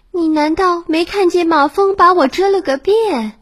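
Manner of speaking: high, whiny complaint; stung daughter wails her grievance; complaining. A narrator's reading. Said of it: "女儿诉苦道". (女儿委屈地诉苦)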